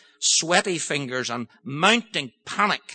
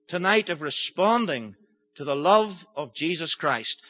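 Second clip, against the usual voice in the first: ordinary talk, nothing marked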